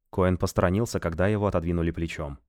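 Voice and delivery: ordinary talk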